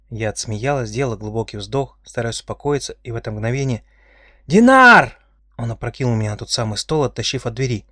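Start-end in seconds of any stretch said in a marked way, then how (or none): 4.5-5.2 high, loud shout of a name. A narrator's reading. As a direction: scream the name for help. Calling out for the guards.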